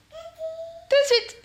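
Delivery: very high, slow, drawn-out sing-song "Tout de suite"; a silly high chant of "Tout de suite"; high slow singsong. Pretend. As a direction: stretch "Tout de suite" way up high like a playful tune. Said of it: "on commence cette petite vidéo tout de suite".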